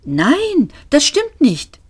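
high-pitched, insistent denial; protesting. A narrator's reading. Ford contradicts the self-pitying robot, protesting.

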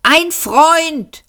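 high, carrying yell; shouting back. A narrator's reading. Man answering Seyfort from afar, shouting back. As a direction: holler the answer down the corridor. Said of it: "schrie der Mann zurück".

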